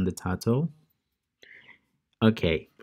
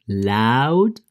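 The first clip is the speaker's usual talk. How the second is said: loud, held-out word; loud demonstration; 5 dB louder than usual. Shown as a demonstration. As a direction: say it big and loud. Repeats loud at high volume.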